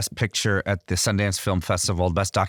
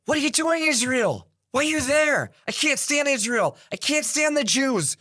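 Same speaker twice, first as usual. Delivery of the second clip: retold angry phone yell. Woman calling from the Bay Area, furious about Israel: high mock yell. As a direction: imitate her furious caller in a raised, strained voice. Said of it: "She's screaming at me on the phone".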